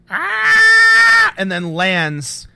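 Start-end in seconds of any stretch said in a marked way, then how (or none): 0.1-1.3 long, high, loud comic "aah"; hammed-up scream while tumbling backwards; mock falling scream. Shot man running backwards in place, mock scream. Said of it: "runs backwards in place and goes".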